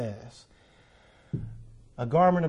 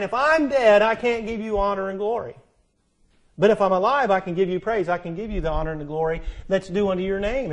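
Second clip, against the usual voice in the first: higher and louder, fading back toward normal; preacher voicing David's bargain with God; pleading preaching; 3 semitones higher, 4 dB louder than usual. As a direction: start with a cry, then ease into the promise.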